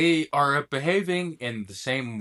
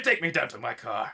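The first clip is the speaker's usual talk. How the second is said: lower-pitched proper British insistence; 5 semitones lower than usual. Acted. Man insisting on going to his car, lower proper British accent. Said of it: "it was just the proper voice".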